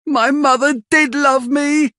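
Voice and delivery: put-on boy crying out for his mother, high and even; Oliver, shouting. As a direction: defend her with all you have. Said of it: "he shouts".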